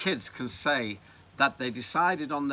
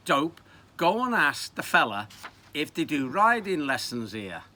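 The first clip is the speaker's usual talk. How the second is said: raised, louder nagging of a mate; impatient explaining; 4 semitones higher, 5 dB louder than usual. Acted. Friend impatiently explains to him.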